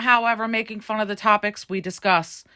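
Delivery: usual speaking voice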